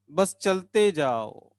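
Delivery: slow singsong urging with a falling end; drawn-out coaxing. Real, not acted. Teacher urging students onward, slow drawn-out refrain. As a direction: stretch each word like a slow refrain.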